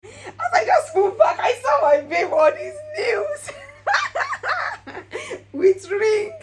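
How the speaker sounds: overwhelmed happy sob-laugh; real tearful laughter recalling the ring; laughing and crying. Real, not acted. Real emotion retelling proposal.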